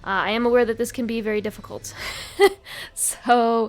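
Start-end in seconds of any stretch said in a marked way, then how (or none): 2.0-2.9 short nervous laugh. Real, not acted. Laughs about difficulty.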